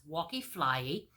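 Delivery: plain reading voice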